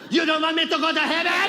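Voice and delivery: loud, high, outraged grandmother; Lola Tinay. Retold or imitated. Grandmother challenges her grandson, loud and indignant. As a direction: demand it shrieky and high, as grandmother. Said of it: "and she'd be like".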